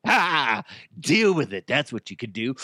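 smoker's brush-off. Acted. Character voice with cigarette bit.

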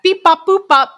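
high, loud robot beeping; robot beeps. Pretend. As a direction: bleep like a little computer. Imitating a quote computer.

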